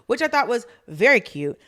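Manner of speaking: ordinary talk, nothing marked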